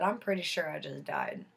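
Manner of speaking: soft, stunned murmur; dazed and quiet. Retold or imitated. Woman telling her friend she just died, soft and stunned. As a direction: say it quietly as if still in shock.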